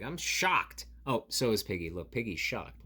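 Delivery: ordinary delivery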